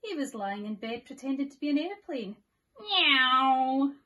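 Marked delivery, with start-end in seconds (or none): 2.8-3.9 loud high cat meow; loud playful kitten cry; meowing baby bear. Acted. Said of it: "went Baby Bear".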